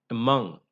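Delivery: among with weak a, stressed MONG; accented on the root. Shown as a demonstration. Teacher lists weak-prefix words, among stressed on MONG. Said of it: "accented on the root".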